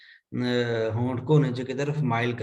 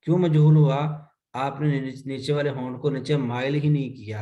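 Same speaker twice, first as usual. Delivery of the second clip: usual speaking voice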